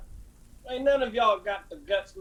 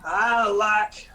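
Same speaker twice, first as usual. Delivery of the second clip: slow teasing drawl; much slower than usual. Acted. Johns taunts the McCoy brothers.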